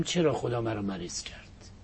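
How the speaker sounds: mock whisper of a self-pitying patient; whispered complaint.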